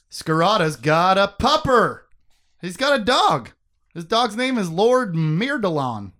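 loud, high, gleeful announcing; excited dog-lover rave; delighted. Real, not acted. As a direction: announce each detail with proud delight.

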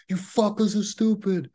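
squeaky high scornful voice; high mocking insult. Time traveler insulting doubters, high and scornful. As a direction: sneer it way up high. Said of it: "I'd be like".

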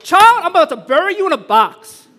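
exasperated mom at home, mock shouting threat; mock furious mom. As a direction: yell at the child in mock rage. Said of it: "And you're at home, you're like".

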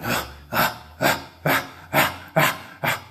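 voiceless demon panting, ah ah; mock panting. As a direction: breathe out rasping ahs without voice. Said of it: "going ... like this at me".

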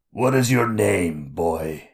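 a hint of a sly creature king; lightly voiced mocking monster king; Skurg King voice. A narrator's reading.

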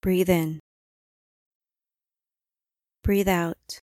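ordinary talk, nothing marked

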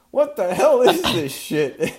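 high, loud furious outburst; raving. A narrator's reading. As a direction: rave at the mess in disgust. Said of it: "the janitor raved".

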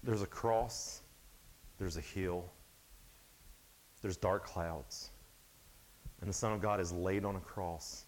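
slow, hushed and grave, with long pauses; solemn sermon narration. Acted. Preacher telling the congregation of the cross, solemn and hushed. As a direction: paint the crucifixion quietly, letting each image land.

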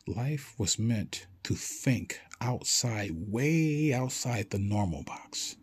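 very slow, weighted, with stretched words; deliberate, spaced-out delivery; emphatic slow point. Real, not acted. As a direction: draw out "way" long for emphasis. Stresses his main point.

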